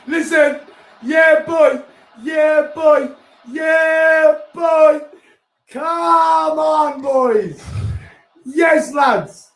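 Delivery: long run of very high, loud celebratory yells; real cheering. Real, not acted. Fan celebrating the winner with mates, high loud yelling. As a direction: roar on the team as if in the stands.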